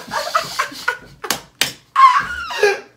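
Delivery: long high-pitched laugh. Real, not acted. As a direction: let a high laugh run out. Laughing at friend's reaction.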